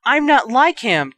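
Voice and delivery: high loud objection; protest. Acted. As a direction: protest out of fear. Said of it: "Dudley protested".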